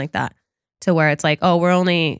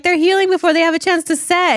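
high mocking voice; 10 semitones higher, faster than usual. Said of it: "Maddox is like".

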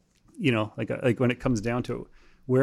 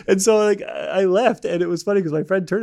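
higher and louder through real laughter; laughing; 8 semitones higher, 6 dB louder than usual. Real, not acted. Guest cracking up at his own anecdote, laughing through the words. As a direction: laugh while finishing the story. Laughing after the jet story.

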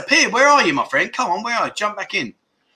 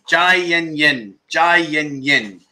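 "Jai Yen Yen" slowly, each syllable drawn out, falling on "Jai"; slow demo; slower than usual. Shown as a demonstration. Teacher modelling the Thai phrase for viewers, slow. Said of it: "What does Jai Yen Yen mean?".

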